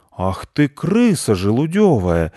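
slow, drawn-out insult rising high; outraged cat; indignant. A narrator's reading. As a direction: scold the thief with mock-fierce outrage. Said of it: "возмутился проснувшийся вслед за ним кот".

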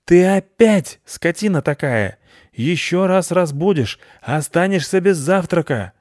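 high-pitched irate scolding; furious owner telling off; angry yelling. A narrator's reading. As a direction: yell threats at the dog from indoors. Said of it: "сердито заорал из дома".

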